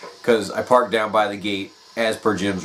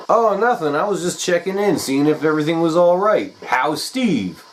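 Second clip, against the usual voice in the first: Jim; 8 semitones higher, 3 dB louder than usual. Retold or imitated. Jim voiced on phone.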